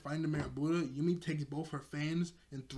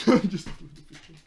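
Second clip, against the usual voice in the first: laughing; 3 semitones higher, 13 dB louder than usual. Real, not acted. Repeats it laughing.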